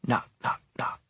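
three soft, hushed, evenly spaced knocks; soft knocking. A narrator's reading. Narrator echoes rapping from behind the storeroom door, softly.

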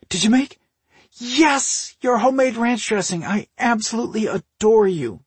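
very high, loud excitement; delighted gushing. A narrator's reading. Sister adoring her brother's cooking, gushing. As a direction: squeal with delight over the food. Sister gushes over homemade dressing.

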